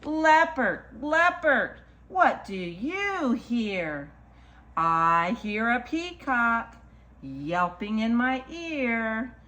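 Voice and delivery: slow, lilting picture-book read; reading to children. Acted. Storyteller reads a picture book to children, lilting. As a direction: read it rhythmically, stretching the key animal words.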